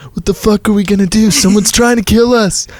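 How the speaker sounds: high, loud, urgent alarm; panicked outburst. A narrator's reading. Alex realises someone's trying to kill them, panicked shout. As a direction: raise pitch and volume in acted panic. Redoes Alex's line in panic.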